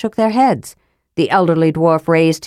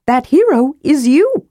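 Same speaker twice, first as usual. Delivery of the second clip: higher, eager kender voice; sly kender explaining the way to the rune; Tass the kender; 7 semitones higher than usual. Acted. Higher playful kender voice.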